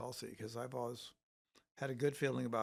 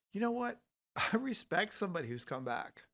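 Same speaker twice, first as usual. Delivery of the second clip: emphatic exclamation; 8 semitones higher, 6 dB louder than usual. Retold or imitated. Exclaiming defence of RFK.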